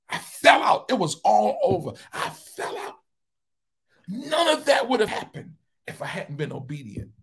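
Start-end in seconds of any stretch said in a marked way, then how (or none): 0.1-1.9 high, loud cry of release. Real, not acted. Preacher reliving being overcome, loud crying shout. 2.1-2.9 breathless high whisper. Real, not acted. Preacher repeating his collapse, breathless whisper.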